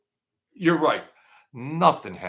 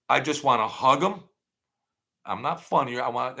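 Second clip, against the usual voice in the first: ordinary delivery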